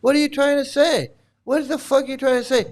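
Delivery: high-pitched exasperated retort; incredulous, pitched well up; indignant challenge. Real, not acted. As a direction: throw the question back, indignant and high, without shouting. Indignant retort to the quoted excuse.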